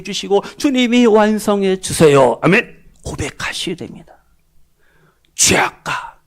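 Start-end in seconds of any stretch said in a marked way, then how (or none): 3.0-6.2 whisper. Real, not acted. Preacher whispering exhortation.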